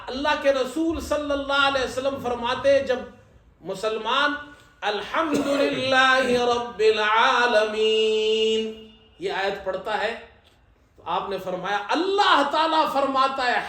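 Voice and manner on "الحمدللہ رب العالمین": very slow, melodic chanted verse; Quran recitation. Shown as a demonstration. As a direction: draw out each word in tajwid chant.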